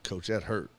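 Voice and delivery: low and soft, calmly stating the hurt; calm hurt kid. Retold or imitated. Hit boy calmly telling his coach, quiet and low. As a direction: say it quietly, almost matter-of-fact. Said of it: "He was like".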